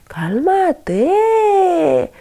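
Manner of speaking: high-pitched acted exasperation; losing patience while ordering calm; exasperated. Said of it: "se va enfadando más y más". (exasperación actuada, tono agudo)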